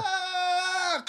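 sudden high cry of shock; real jolt at the splat; startled shout. Real, not acted. Reacting to the falling man.

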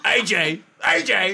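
mock mobster yell. Sopranos impression, yelling name.

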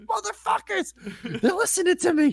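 very high, laughing disbelief; mock paranoid outrage. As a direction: squeal that they stole your idea. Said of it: "And I'm like".

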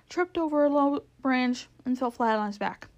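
usual speaking voice

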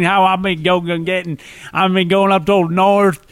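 mangled Cockney that drifts Southern, unhurried; bad Cockney accent. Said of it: "that wasn't even Cockney".

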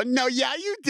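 high-pitched mock enthusiasm; imitating an overexcited fan. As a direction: gush it, voice shooting way up. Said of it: "they're way more excited than you are".

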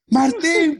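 high-pitched mock holler; imitated yell. Mom calling husband she thinks is downstairs, imitated yell. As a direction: call as if he were downstairs. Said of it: "pegando un grito como si estuviera abajo".

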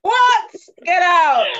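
"What?" shot up very high and loud; shocked disbelief. Real, not acted. Telling friends of a cousin's question, a loud high shocked outburst. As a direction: cry out in amused outrage.

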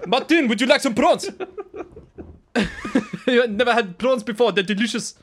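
put-on loud, high South African accent. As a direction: ham up a South African friend. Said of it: "sounded ridiculous".

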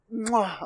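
kiss sound. Acted. Blowing a kiss to brand.

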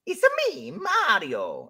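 quick mock Mario catchphrase, high start; Mario impression. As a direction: toss off a Mario "It's a me". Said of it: "what range does Mario need".

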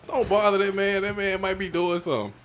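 high-pitched retold plea; retold protest. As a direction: plead on the man's behalf, voice up high. Retold plea to leave him be.

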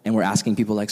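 usual speaking voice

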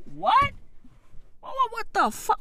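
high, quiet squeal of disbelief; high-pitched baffled reaction; stunned disbelief. Retold or imitated. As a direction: squeak it out, incredulous. Acting out his stunned reaction.

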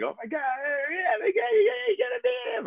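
hammed-up high-pitched shrieking; mock freaking out. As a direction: squeal hysterically in imitation of starstruck bartenders. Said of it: "like, freaking out".

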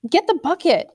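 put-on girl calling to her brother for the bucket. A narrator's reading. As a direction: call the brother over. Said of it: "Han called to Nut".

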